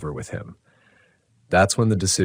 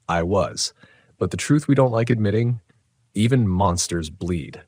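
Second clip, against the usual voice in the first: plain reading voice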